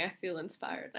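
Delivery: ordinary talk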